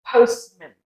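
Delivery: postman ending in weak "mun"; schwa. Shown as a demonstration. Teacher demonstrating postman, schwa ending. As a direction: postman with the A reduced to "uh". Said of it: "that's when you use the schwa sound".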